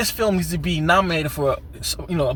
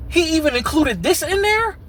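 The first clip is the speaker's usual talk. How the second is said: high-pitched incredulous question; baffled; 12 semitones higher than usual. Retold or imitated. Reviewer recalling his reaction to vampires dancing, baffled. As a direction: voice leaps up in disbelief. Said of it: "I was baffled".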